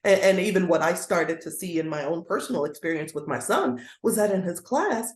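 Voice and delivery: normal talking voice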